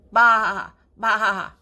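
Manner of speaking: loud bleating baa; lamb bleat. Acted. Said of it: "The cute little lambs".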